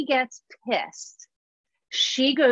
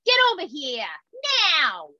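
raised, scolding Queens yell; Queens accent; 4 semitones higher than usual. Acted. Mom calling her kids over, Queens accent. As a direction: snap an order in a Queens accent. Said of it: "I yell at them in a Queens accent".

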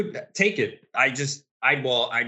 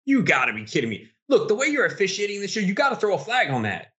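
outraged protest; 9 semitones higher than usual. Real, not acted. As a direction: argue with the referees in disbelief. Outraged at missed late-hit call.

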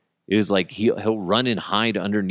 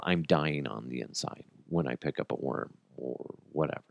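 ordinary talk, nothing marked

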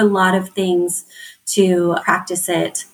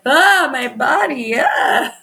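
slow, higher hammed-up groan ending in a breathy mock cry; mock teen anguish; 5 semitones higher, slower than usual. Junior high kid wailing about puberty, hammed-up mock cry. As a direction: play an overwhelmed teen, hammed up. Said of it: "everyone in junior high is like".

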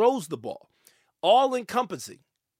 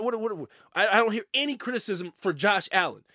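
ordinary talk

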